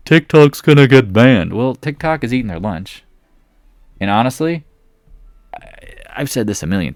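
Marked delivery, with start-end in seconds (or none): 0.1-1.5 slow, loud, higher-pitched mimicry; drawn-out mock proclamation; mocking quote. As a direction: quote the hype slowly and loudly, pitched up. Mocks a hopeful market narrative.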